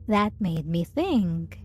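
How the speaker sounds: ordinary talk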